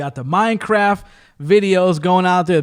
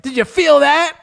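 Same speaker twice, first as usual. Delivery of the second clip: high, indignant mock challenge; mock tough gamer; 11 semitones higher, 4 dB louder than usual. VR user striking back at the troll, high indignant mock. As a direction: puff up and hit back. VR guy after hitting troll.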